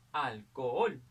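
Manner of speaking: "alcohol" with a loud, lengthened final OL; stress on last syllable. Shown as a demonstration. Teacher locating the tonic syllable, loud stress on the end of "alcohol". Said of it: "la sílaba tónica está aquí".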